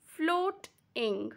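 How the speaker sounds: Floating as two slow beats, float-ing; a gap inside Floating; split into syllables. Shown as a demonstration. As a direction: sound out Floating in two parts. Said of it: "it goes like this".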